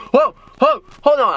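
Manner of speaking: high, loud mock panic; retold startled "stop right there"; alarmed interruption. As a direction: cut in urgently, pitched right up. Acts halting the agent in alarm.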